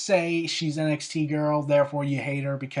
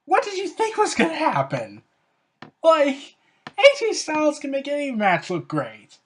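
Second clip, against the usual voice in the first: much higher and louder, bursting with excitement; excited; 9 semitones higher, 4 dB louder than usual. Real, not acted. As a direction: gush about the match. Excited about AJ Styles.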